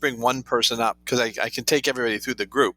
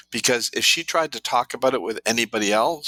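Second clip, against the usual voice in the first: ordinary delivery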